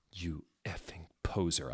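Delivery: hushed unvoiced whisper; whispered insult. Narrator whispering at Dumbledore behind his back. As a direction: hiss the insult under your breath. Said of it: "I muttoned".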